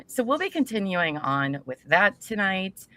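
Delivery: usual speaking voice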